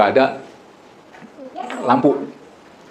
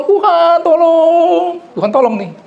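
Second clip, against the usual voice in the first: high, loud calling out to God from the ravine; desperate cry for help; 4 dB louder, slower than usual. Retold or imitated. Fallen pastor cries to God from the ravine, high and loud. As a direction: cry out for rescue in the dark. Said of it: "Dia berseru sama Tuhan".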